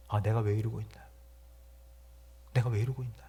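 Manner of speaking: soft, low, despairing self-question; downcast sigh. Retold or imitated. Pastor voicing a discouraged believer, softly despairing. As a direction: ask yourself quietly in despair.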